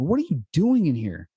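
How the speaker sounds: sharp high demand at the door; drill sergeant. Retold or imitated. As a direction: confront the kid like a drill instructor. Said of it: "the drill instructor voice inside of me".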